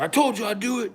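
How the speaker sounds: high smug taunt. Retold or imitated. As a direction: gloat that you followed through. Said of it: "Scotty was like".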